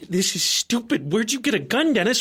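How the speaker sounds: building into a scared yell; panicked. Acted. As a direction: cousin pleads with Dennis over the gun. Pleading with armed cousin.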